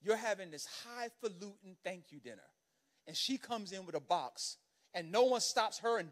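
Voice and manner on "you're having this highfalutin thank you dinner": usual speaking voice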